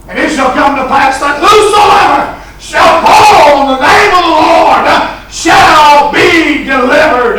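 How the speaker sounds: shouted, high, rhythmic preaching with "uh" breaths; full-force preaching. Acted. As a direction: preach it out at the top of the voice. Preached scripture reading.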